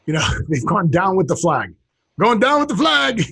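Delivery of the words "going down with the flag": high-pitched cry in a put-on voice; mock exclamation. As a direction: call it out high, playing it up. Acting out the saying as exclamation.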